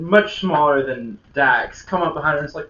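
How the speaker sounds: ordinary delivery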